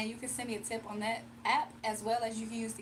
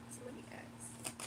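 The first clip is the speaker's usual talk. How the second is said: hushed aside; 2 semitones lower, 14 dB quieter than usual. Real, not acted. Pausing to pull cards quietly.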